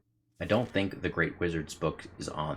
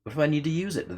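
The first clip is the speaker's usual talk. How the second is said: much higher, acted heroine voice; high character voice; 12 semitones higher, 3 dB louder, faster than usual. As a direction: pitch the question up high in character. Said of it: "rereads the line in character".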